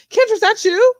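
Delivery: put-on high-pitched stab at an English accent; attempted English accent. English fan spotting the comedian at the store, attempted English accent. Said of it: "in your accent, I can't do it".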